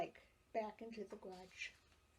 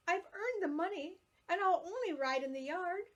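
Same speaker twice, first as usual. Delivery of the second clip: higher, louder eager plea; pleading child; 7 semitones higher, 5 dB louder than usual. A narrator's reading. Girl pleading with her mother, high and eager. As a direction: beg Mother to let her keep the bike.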